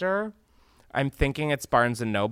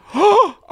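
loud high shocked gasp; sharp gasp; 11 dB louder than usual. Real, not acted. Hears the answer is Nobu.

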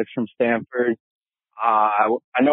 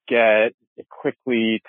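ordinary talk, nothing marked

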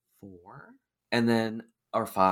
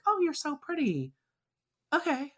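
high, softer fake-sweet praise; mock polite gushing; 7 dB quieter than usual. Guest imitating herself at a dull drag show, mock polite gushing. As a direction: imitate a politely bored admirer, pitched way up. Mocking reaction to boring drag shows.

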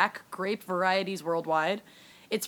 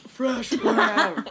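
laughing; 5 semitones higher than usual. Real, not acted. Echoing with a laugh.

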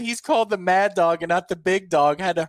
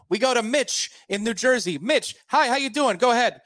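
higher, impatient talk-radio host prodding a caller; Mad Dog; 6 semitones higher than usual. Retold or imitated. Sports host prompting caller Mitch on air, mock pushy host. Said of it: "the Mad Dog, has a caller on, and he'll be like".

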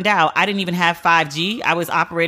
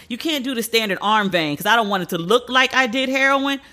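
usual speaking voice

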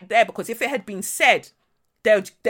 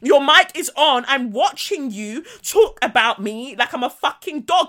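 higher, insistent, quickfire accusation; exasperated retold confrontation; indignant retort; 4 semitones higher than usual. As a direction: hammer home that you witnessed it all. Indignant retort to being placated.

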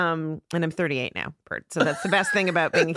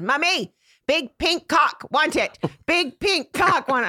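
put-on high, slow toddler demand, "clock" said as "cock"; little girl insisting, dropping the L in "cock"; toddler mispronouncing; 9 semitones higher, 4 dB louder, slower than usual. Toddler waking her mother at night, high demanding voice. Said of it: "she couldn't say L's".

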